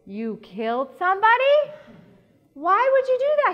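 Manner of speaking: hammed-up horrified mom; mock alarm. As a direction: overplay the panic for a laugh. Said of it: "I'm like".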